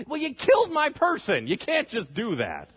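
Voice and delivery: high, loud outburst that drops and slows; indignant protest. Acted. Victim's family answering the offer of money, indignant. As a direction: object in mock outrage.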